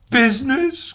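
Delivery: high, loud anguished outcry; anguished cry. Acted. Marley's ghost crying out at Scrooge, high and loud. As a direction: cry out in torment. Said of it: "cried the ghost".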